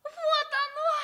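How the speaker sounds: high, loud cry of discovery; a girl spotting her lost ring; joyful find. Acted. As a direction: burst out with delight at finding it.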